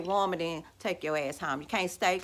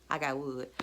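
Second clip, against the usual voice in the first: mock gloating laugh; 5 semitones lower, 5 dB quieter than usual. Real, not acted. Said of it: "I could laugh at your ass".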